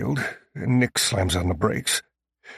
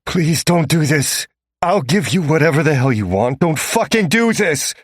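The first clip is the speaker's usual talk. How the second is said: louder, pleading, slowing on please; desperate begging; 5 semitones higher, 5 dB louder than usual. A narrator's reading. Captive man begging Tink not to inject him, desperate. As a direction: beg Tink with everything you have. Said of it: "I'm begging".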